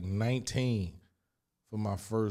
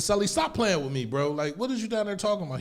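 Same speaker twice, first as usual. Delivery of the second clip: higher, louder pleading; insistent call; 11 semitones higher, 5 dB louder than usual. Retold or imitated. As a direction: press him, higher and louder. Arguing with cellmate.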